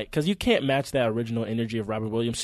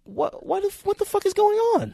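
high, loud exasperated yell; outraged shout; 4 dB louder than usual. Real, not acted. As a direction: shout it in disbelief. Outraged at whitewashed casting.

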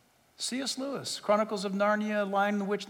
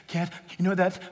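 mimicking someone choked up, breaking off; imitated sobbing daughter, words catching; imitated crying; 3 semitones lower than usual. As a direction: act out tearful, gasping speech. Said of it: "and she's crying".

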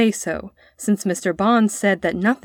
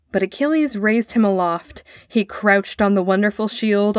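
ordinary delivery

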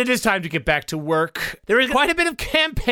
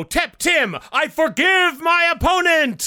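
headline announcer; 9 semitones higher, slower than usual. Acted. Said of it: "I can see the headlines now".

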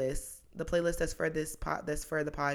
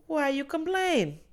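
higher, slow Jamaican accent impression; 10 semitones higher, 4 dB louder, slower than usual. Jamaican masseuse teasing complaining tourists, slow Jamaican accent. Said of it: "I'm not gonna do the accent the whole way".

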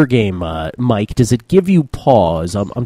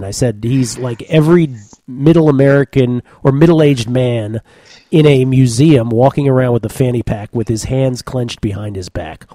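usual speaking voice

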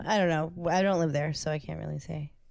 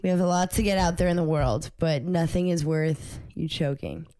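ordinary delivery

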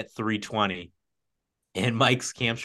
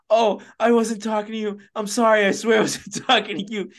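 high, louder imitation of frantic apologizing; flustered apology; 11 semitones higher, 5 dB louder than usual. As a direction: act out a mortified runner pleading innocence. Acting runner apologizing to old lady.

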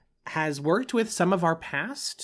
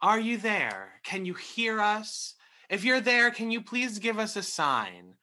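talking to a ghost; 7 semitones higher than usual. Pretend. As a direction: call out to a spirit, pleading and eerie. Mock séance, calling to a spirit.

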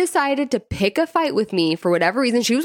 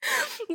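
burst of high laughter; 10 dB quieter than usual. Real, not acted. Laughs at the friend's teasing.

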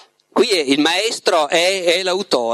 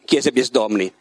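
plain reading voice

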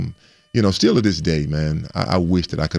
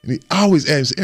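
ordinary talk, nothing marked

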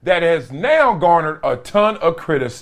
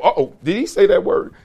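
normal talking voice

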